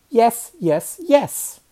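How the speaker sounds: put-on high, loud childish contradiction; stubborn rising-pitch insistence; insistent bickering. Children bickering over whether cats swim.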